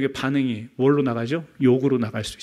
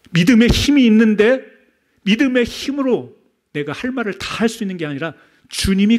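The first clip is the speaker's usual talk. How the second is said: preaching loud; 7 semitones higher, 3 dB louder than usual. Real, not acted. Emphatic sermon climax.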